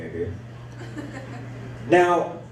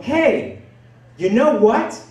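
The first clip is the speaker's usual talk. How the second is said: higher, mother buttonholing strangers; chatty mother; 6 semitones higher, 3 dB louder, slower than usual. Retold or imitated. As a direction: blurt it out to someone just met, higher. Mother accosting new acquaintances.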